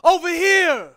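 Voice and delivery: calling out. Acted. Sinner flags down God to be heard, calling out. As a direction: hail someone far off, drawing out the last words. Poet acts out hailing God.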